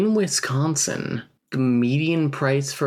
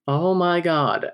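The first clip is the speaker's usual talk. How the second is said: higher, drawn-out exasperated groan of words; embarrassed anger; 6 semitones higher, slower than usual. A narrator's reading. Lauren mortified at the table, mildly embarrassed anger. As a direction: stretch it out slowly, mortified and cross. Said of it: "out of embarrassment and anger".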